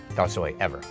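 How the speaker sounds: normal talking voice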